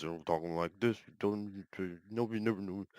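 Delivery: mock mumbling. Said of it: "people who mumble their words".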